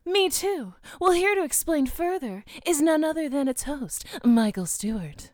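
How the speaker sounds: announcer voice. Pretend. Announcer introducing the host.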